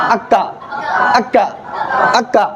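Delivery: अक्का with a light front K, short; महीन आवाज. Shown as a demonstration. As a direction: say अक्का thin and unrounded. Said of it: "महीन आवाज निकालेंगे". (अक्का को महीन, बारीक)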